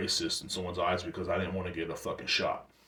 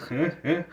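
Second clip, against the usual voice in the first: awkward cool-guy noises; 7 semitones higher, faster than usual. Retold or imitated. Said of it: "I'm like".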